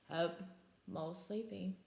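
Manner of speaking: ordinary delivery, nothing marked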